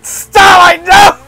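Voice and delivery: loud, high cry of protest; protesting shout. Real, not acted. Player begging Starlight to stop, protesting shout. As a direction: yell out in alarmed objection.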